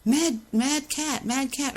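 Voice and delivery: high, very slow taunting singsong; a mocking singsong taunt, high and drawn out. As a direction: tease the caged cat. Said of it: "and they go".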